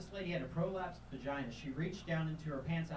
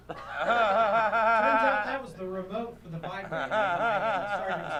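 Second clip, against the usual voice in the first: louder, slow words through laughter; laughing; 7 semitones higher, 15 dB louder, slower than usual. Real, not acted. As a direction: laugh it out while telling the punchline.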